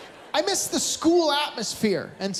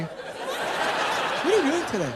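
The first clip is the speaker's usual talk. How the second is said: put-on helper kid; 4 semitones higher, much slower than usual.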